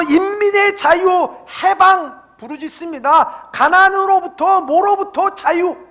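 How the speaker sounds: high, loud imitation of rallying cries; crying out slogans. Preacher mimics communists crying out for freedom, high and loud. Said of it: "자유를 외치게 되어 있습니다".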